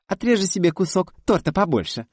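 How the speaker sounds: put-on high, loud child voice; excited little girl. A narrator's reading. Girl eagerly telling the guest about the cake. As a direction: chirp it out eagerly.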